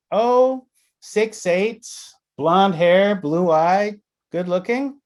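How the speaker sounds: high-pitched imitation of a client; imitated client. Client picturing the man from the phone, high-pitched imitation.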